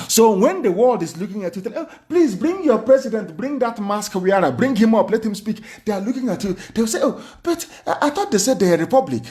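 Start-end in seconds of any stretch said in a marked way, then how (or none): 1.8-5.6 high-pitched acted request; put-on plea, pitched up; imagined onlookers. Imagined world asks for the president. 7.0-9.2 high, quick acted objection; mock puzzlement, pitched up and hurried; puzzled onlookers. Imagined world puzzled by the claim.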